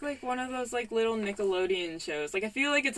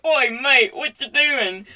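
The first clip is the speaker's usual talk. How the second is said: hammed-up British accent, louder and higher; bad British accent; 4 semitones higher, 6 dB louder, slower than usual. Friends come up to the guy, horrible British accent. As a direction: attempt a British mate's greeting, badly. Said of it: "that was horrible accent".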